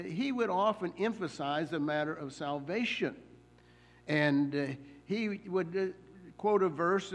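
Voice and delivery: ordinary talk, nothing marked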